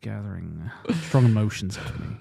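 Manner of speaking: lightly put-on halting actor voice; Hugh Grant. Mocking Hugh Grant weeping at the tape, halting lightly put-on voice. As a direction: stammer through it like a mopey leading man. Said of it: "He's like".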